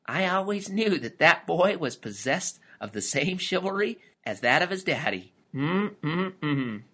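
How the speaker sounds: lightly voiced grandmotherly pride; proud. A narrator's reading. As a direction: admire him with a knowing hum at the end. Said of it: "whispered proudly".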